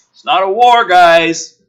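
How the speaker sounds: loud, high mock Woodhurst; mock officer voice. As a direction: sneer it like the colonel. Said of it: "going back like".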